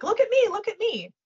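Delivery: high mock plea for attention; attention-seeking. Coach mimicking people begging to be looked at, high and needy. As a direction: imitate a needy show-off, pitched well up. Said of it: "because you're not like".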